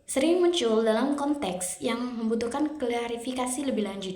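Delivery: ordinary talk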